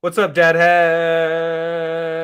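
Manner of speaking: loud hail with a long-held final word; drawn-out greeting. Real, not acted. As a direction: call out and stretch the name way out. Greets arriving viewer Dad Hat.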